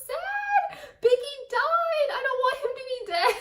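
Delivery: high, hammed-up sobbing lament; mock wailing. Retelling bawling over [name]'s death, high wailing lament. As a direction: whine it out like a heartbroken fan. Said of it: "and I was like".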